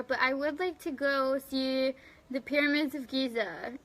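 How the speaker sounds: usual speaking voice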